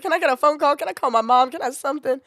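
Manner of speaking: high, loud, rapid-fire begging; giggly mock desperation; cry-laughing plea.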